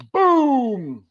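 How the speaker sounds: a sudden high, loud boom of a shotgun blast; mimicked shotgun boom; imitated gunshot. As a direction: burst out with the boom like the gun just fired. Gunshot heard in woods.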